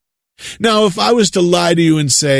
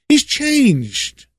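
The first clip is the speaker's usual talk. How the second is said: sarcastic; 9 semitones higher than usual. Pretend. Sarcastic mock claim.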